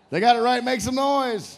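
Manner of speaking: loud, high hype-man shout; high, booming game show cheer; calling for applause. Real, not acted. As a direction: whip the crowd up with a big shout. Host calls for applause.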